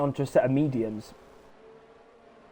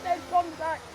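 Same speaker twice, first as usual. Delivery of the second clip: lively live play-by-play; excited sim racing commentator; race commentary; 6 semitones higher than usual. Acted. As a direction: call the race as it happens.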